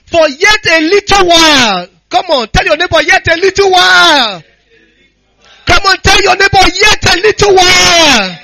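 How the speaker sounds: loud, high-pitched proclamation of scripture; rousing pulpit shout; full-force preaching. Acted. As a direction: preach it to the congregation, drawing out key phrases.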